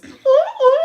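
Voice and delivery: high, loud hooting call; loud high ooh calls; signal call ooh. Retold or imitated. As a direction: hoot it out like a signal to friends. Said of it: "start making the same noise".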